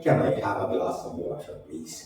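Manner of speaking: low, soft, slow simple English; understated simple English, low and quiet; simple Indian English. Shown as a demonstration. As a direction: drop the accent for plain Indian English. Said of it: "ये simple English हुआ".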